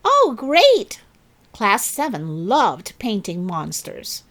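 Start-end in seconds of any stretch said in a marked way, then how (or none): 0.1-1.0 high, loud eager cheer; excited cheer. Acted. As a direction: cheer like a delighted class. Class cheers at monster task.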